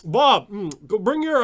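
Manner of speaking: mock player calling out. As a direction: call a fellow player over impatiently. Mocked player calls to Bob.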